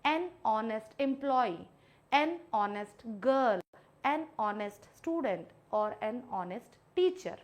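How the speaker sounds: "an honest" linked with no H, slowly; slow model phrases, "honest" starting on O; silent H. Shown as a demonstration. Teacher gives students examples, "honest" with H silent. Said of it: "तो यहाँ पे इसको मैं पढ़ूंगी".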